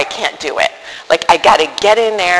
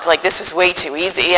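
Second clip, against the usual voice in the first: normal talking voice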